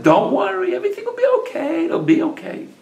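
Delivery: high, soothing wife voice; wife's reassurance. A narrator's reading. Rachel comforts her downcast husband, high-pitched soothing voice.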